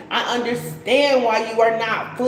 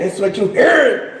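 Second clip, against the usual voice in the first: mock shout; 4 dB louder than usual. Teasing a viewer's accent.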